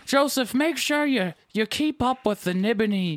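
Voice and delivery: high-pitched, hammed-up old-timer; old person voice. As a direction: play an old person giving advice. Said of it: "with an old person voice".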